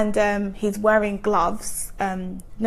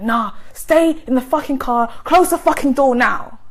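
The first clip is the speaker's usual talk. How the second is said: raised, higher-pitched command; retold furious demand, building louder; imitated angry order; 3 semitones higher, 4 dB louder than usual. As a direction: order someone sharply, as an angry ex. Ex orders her to stay.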